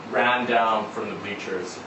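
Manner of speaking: usual speaking voice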